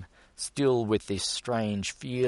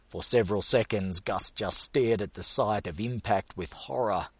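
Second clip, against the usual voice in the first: ordinary delivery, nothing marked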